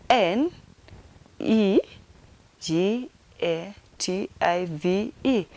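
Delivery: slow letter-by-letter spelling; spelled out. Shown as a demonstration. Teacher finger-spelling negative for learners, spelled out. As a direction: give each letter its own beat. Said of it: "let me finger spell it".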